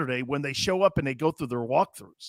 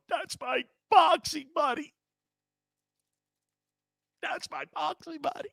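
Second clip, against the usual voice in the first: mock crying; 9 semitones higher, 6 dB quieter than usual. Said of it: "He'd just start crying".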